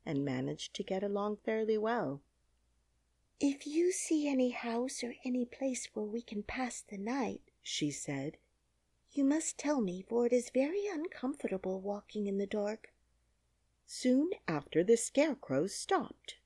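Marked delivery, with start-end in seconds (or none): 3.4-7.4 put-on high, hushed girlish whisper; a lightly voiced tired little girl in the dark; breathy whispered child's plea; young girl Dorothy. Dorothy speaks in the story. 9.1-12.9 put-on higher, light girlish voice; a lightly voiced little girl, uneasy in the dark; young girl Dorothy. Dorothy speaks in the story.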